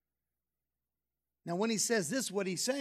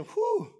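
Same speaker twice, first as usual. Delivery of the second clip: high mock whew; 12 semitones higher than usual. Real, not acted. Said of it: "reacting to the macho man".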